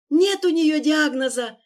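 high tearful shout; angry crying. Acted. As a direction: shout it through tears. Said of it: "сквозь слёзы прокричал Давид".

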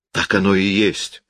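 ordinary delivery, nothing marked

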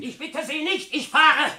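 high, loud and cutting; sharp high refusal; curt shout. Acted. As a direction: brush him off with a shout. Daragan cuts off the plea.

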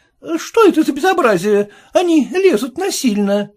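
put-on high, offended young woman; lady's voice, indignant. A narrator's reading. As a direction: protest at the intruders.

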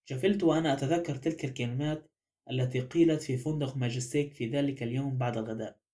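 ordinary delivery, nothing marked

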